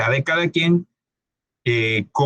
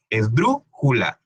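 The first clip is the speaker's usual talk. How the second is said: Esdrújula said slowly, syllable by syllable, with the stress on drú; teacher spells out Esdrújula slowly, hitting drú; slow stress demo; 4 semitones lower, much slower than usual. Shown as a demonstration. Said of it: "para indicar en dónde vamos a acentuar".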